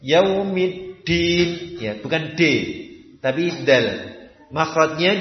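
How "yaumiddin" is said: Yaumiddin chanted slowly with a dal in place of an English D; slow model reading of Yaumiddin; Arabic dal. Shown as a demonstration. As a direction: sing Yaumiddin slowly, tongue at the base of the front teeth. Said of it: "jangan dibaca seperti huruf D".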